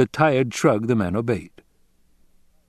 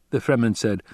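ordinary delivery, nothing marked

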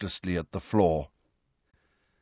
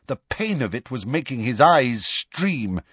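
ordinary delivery

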